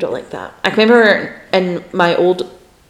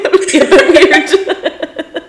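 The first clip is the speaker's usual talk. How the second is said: laughing; 11 semitones higher, 4 dB louder, slower than usual. Real, not acted. Laughing at own slip.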